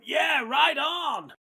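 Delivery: slow, high drawn-out cheer; hammed-up fan yelling approval; enthusiastic local fan. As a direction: call it out like an excited American dad in the stands. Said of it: "some guy went".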